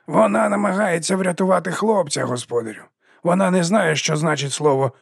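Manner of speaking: high-pitched, urgent servant's voice; pleading servant. A narrator's reading. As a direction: explain it up high to your master. Albert anxiously answers his master.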